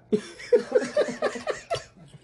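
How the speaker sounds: loud, high-pitched burst of laughter; big laugh. Real, not acted. Cracking up at the slingshot joke, loud laughter. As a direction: crack up hard.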